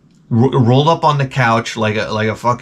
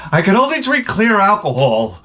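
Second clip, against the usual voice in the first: mocking voice; 7 semitones higher than usual. Mocking picky drinkers.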